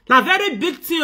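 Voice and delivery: loud incredulous emphasis on very; loud emphatic repeat. Real, not acted. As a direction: push it out with force. Repeats phrase louder.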